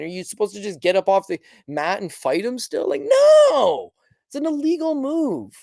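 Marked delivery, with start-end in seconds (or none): 2.9-5.5 NO flung out, much higher and louder; emphatic no. Real, not acted. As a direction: shut the idea down hard. Outburst against fighting on.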